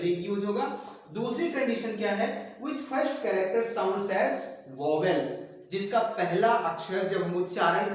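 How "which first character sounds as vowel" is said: slow dictation. Shown as a demonstration. Teacher dictating rule to class.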